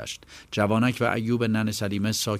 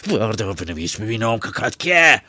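loud, rough forced command; furious demand, much louder; angry order; 3 semitones higher, 10 dB louder than usual. Acted. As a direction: shove the words at him. Boksor Ali forces the boy.

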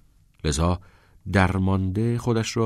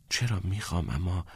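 softer, trailing off; quietly; 6 dB quieter than usual. A narrator's reading. Shy Todd admits it quietly to Neil. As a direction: say it under your breath. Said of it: "تاد آرام گفت".

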